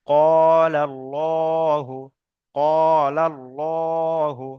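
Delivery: "কলাল্লাহু" chanted very slowly, long qo and thick llo; demonstration recitation. Shown as a demonstration. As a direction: stretch "কলাল্লাহু" with held vowels and a heavy L. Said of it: "টান দিয়ে পড়তে হয়". ("কলাল্লাহু" টেনে টেনে, পুর ল দিয়ে তিলাওয়াত)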